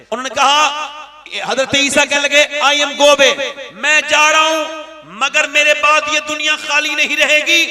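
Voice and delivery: loud, high, rousing preacher voice; booming political rally speech. Acted. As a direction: build the crowd up, preaching loudly. Preacher builds to a booming climax. (ऊँची बुलंद तक़रीर की आवाज़)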